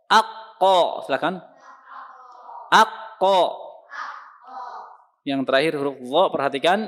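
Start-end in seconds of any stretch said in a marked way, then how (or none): 0.1-1.1 heavy, rounded "Akko" as a model; thick qaf. Shown as a demonstration. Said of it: "Kemudian huruf qaf". 2.7-3.6 "Akko" loud and high with a back-of-mouth q; thick qaf. Shown as a demonstration. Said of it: "Kemudian huruf qaf".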